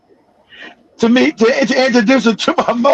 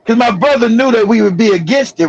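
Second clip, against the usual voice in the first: usual speaking voice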